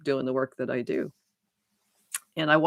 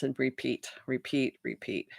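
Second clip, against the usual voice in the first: each repeat softer until it almost vanishes; fading repeat; 2 semitones lower, 9 dB quieter than usual. Shown as a demonstration. Telling listeners about the endless cycle, fading to near silence. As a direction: let the words die away like a loop running down.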